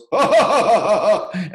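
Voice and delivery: loud, high put-on laugh; mocking imitated laugh. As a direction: imitate his laugh loudly while mocking him. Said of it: "he rolls his eye and goes".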